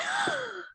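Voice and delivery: high delighted little gasp; girl's gasp. Retold or imitated. Little girl seeing the fairy, high delighted gasp. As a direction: gasp in wonder like a small child. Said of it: "And she's like".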